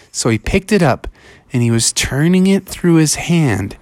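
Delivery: plain reading voice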